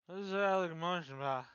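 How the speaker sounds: put-on slurred drunken guard. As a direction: slur it like a drunk. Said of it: "very drunkenly".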